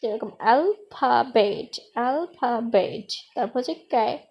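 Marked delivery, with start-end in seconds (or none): 0.4-3.1 alphabet in three slow beats, al-pha-bet; teacher sounding out alphabet part by part; split into syllables. Shown as a demonstration. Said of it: "ট্রাইসিলেবল হচ্ছে তিনবার উচ্চারিত হবে".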